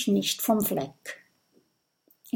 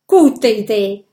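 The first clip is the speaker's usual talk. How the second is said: loud, approving call; raised voice at normal pitch; 9 dB louder than usual. A narrator's reading. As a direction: call out your agreement. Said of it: "ruft Oskar".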